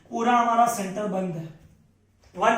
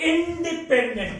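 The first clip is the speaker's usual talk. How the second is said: "independent" slow and higher; drawn-out key term; 6 semitones higher, much slower than usual. Real, not acted. Teacher has students repeat the key term, slow drawn-out delivery. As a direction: stretch independent syllable by syllable, pitched up.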